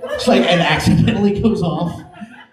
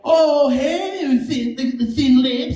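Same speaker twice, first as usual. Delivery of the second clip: high, taunting mock jeer; heckling inmate; 8 semitones higher, much slower than usual. Comic imitating an inmate heckling him, high mock taunt. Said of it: "they went".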